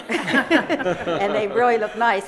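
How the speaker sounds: real laugh running into the words, slowed; chuckling through it, pleased with herself. Real, not acted. Resident laughs while praising the town's decorations, laughing. Laughing at her own brag.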